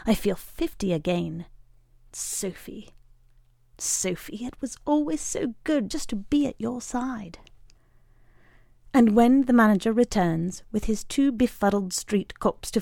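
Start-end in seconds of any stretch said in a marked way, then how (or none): 2.1-7.4 soft, hushed, near whisper; tender. A narrator's reading. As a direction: murmur it to her lovingly. Tender address to Sophie.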